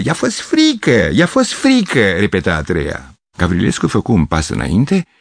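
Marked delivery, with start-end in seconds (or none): 0.0-2.1 high, singsong taunt; taunting girl. Acted. Third girl mocking frightened Gavrilescu, high taunt. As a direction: taunt him like a schoolgirl.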